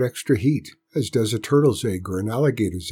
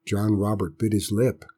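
plain reading voice

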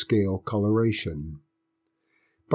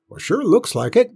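put-on high, reedy old country grandpa; grudging old man conceding the point; old grandpa admitting; 12 semitones higher, 5 dB louder than usual. A narrator's reading. Grandpa admits to the boy, old country voice. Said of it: "Grandpa admitted".

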